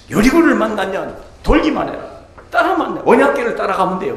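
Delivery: high, loud preaching cry; rally the congregation; fervent preaching. Acted. Pastor urging the church to follow the ark, high and loud.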